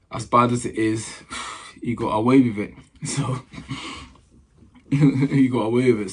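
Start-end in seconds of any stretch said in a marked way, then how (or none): 2.8-6.1 laughing it out, a bit louder; real laughter through the words. Real, not acted. Telling viewers the agent escaped, laughing.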